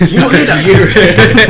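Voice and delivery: teasing, laughing. Retold or imitated. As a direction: wind up your friend while cracking up. Teasing his mate, laughing.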